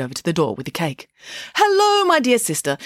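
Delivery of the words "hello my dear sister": high, loud, gushing hello; effusive greeting. A narrator's reading. Scheherazade greets her sister at the door, effusive. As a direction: greet with showy delight, starting way up high.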